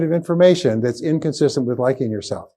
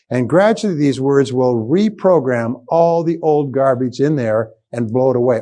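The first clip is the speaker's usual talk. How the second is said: ordinary talk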